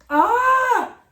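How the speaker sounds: sudden high, loud wail; tearful cry out. Real, not acted. Writer trying not to cry on camera, tearful wail. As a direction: cry out as the tears threaten. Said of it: "I'm not crying".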